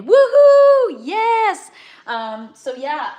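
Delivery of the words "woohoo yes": high, loud whoop of joy; excited cheer. Acted. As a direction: cheer it out at full voice. Cheering while reading out chat.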